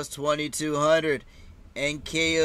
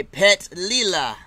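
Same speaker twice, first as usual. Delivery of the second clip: usual speaking voice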